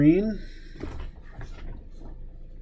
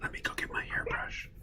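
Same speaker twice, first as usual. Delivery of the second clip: hushed ASMR whisper; 16 dB quieter than usual. Shown as a demonstration. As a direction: whisper it close to the mic. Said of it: "Or I could whisper".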